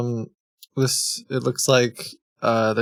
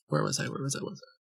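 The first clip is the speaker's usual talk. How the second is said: each repeat softer until it nearly vanishes; fading to a hush; 11 dB quieter, much faster than usual. Shown as a demonstration. Streamer losing his place, repeating himself ever softer. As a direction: let it trail away into silence, asking himself.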